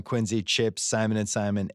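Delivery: ordinary talk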